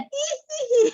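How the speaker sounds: very high, piercing hee-hee giggle; copycat cackle. Retold or imitated. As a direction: mimic someone helplessly echoing the possessed laugh. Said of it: "jemaat ini ikutan dia".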